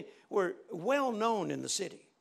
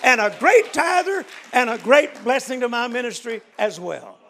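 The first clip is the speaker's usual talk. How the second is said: louder and higher, stretched out, slow; preaching at full force; 5 semitones higher, 11 dB louder than usual. Acted. Preacher to the church about Bob, full force. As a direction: proclaim it to the congregation.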